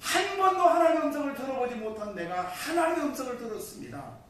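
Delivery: loud, high exultant declaration; acted-out awed testimony to God; overwhelmed thankful prayer. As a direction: proclaim it in amazed gratitude. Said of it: "감사 기도했습니다".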